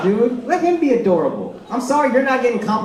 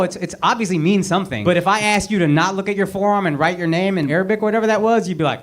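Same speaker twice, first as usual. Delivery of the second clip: ordinary talk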